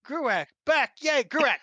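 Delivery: high, loud happy welcome; excited cheer. Real, not acted. Game master cheering as the player returns, excited and loud. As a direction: cheer him back in.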